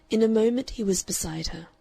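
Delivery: ordinary delivery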